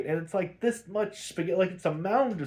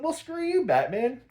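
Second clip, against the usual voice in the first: much higher, mocking Catwoman comeback; 9 semitones higher than usual. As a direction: fire it back in a high put-on Catwoman voice. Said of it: "And she's like".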